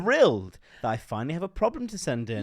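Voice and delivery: excited letter read. Acted. Reading listener's letter excitedly.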